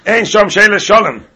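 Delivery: mock proclamation. Retelling shower reply to Shalom, hammed-up declaiming.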